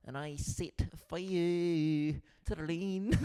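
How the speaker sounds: put-on slow sung Kiwi accent; New Zealand accent. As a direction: croon it in a mock Kiwi accent. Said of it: "Imagine if she sang in New Zealand".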